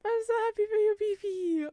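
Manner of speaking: high, gushing joy sliding downward; overjoyed gushing. Real, not acted. Congratulating Beefy again, overjoyed gushing. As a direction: gush in a high voice for a dear friend.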